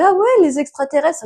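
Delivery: high, excited fake wonder; fake amazement. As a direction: play along, wide-eyed. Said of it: "J'ai fait".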